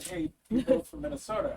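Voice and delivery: retold friendly greeting. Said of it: "she said".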